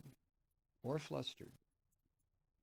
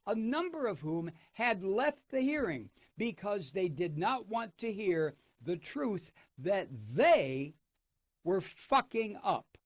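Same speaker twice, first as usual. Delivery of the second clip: angry rant; 4 semitones higher, 5 dB louder than usual. Real, not acted. Host rants at Republicans.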